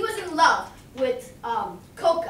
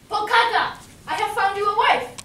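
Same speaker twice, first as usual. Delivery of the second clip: louder, higher call to Pokaka; 7 semitones higher, 7 dB louder than usual. Acted. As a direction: call out gladly to Pokaka, loud and high. Kahu calls out to Pokaka.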